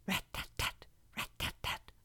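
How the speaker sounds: soft whispered knock sound. Acted. Fox knocks at door.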